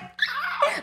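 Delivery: sharp high gasp of shock; mock shocked gasp. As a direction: suck in air, stunned. Shocked at dad's reply.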